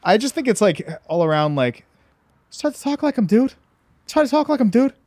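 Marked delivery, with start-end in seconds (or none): 2.6-4.9 put-on high Boston-accented tough guy voice, much higher. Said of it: "Would you do a [name]?".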